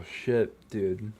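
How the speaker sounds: usual speaking voice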